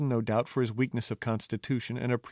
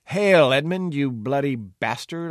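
a louder mocking shout, higher at first; the jester shouting an insult down at Edmund; calling over the wall; 3 semitones higher, 7 dB louder, slower than usual. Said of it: "I called over the wall".

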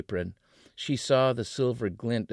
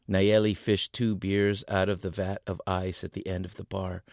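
plain reading voice

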